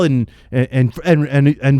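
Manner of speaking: frustrated stutter, repeating words. Real, not acted. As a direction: stumble over the words in frustration. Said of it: "I'm stuttering".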